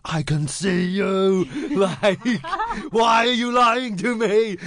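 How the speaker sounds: high, drawn-out exasperated protest; frustrated. Retold or imitated. Reliving catching his date lying, high exasperated protest. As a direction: call her out, dragging the words in disbelief. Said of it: "everyone listening can hear the frustration".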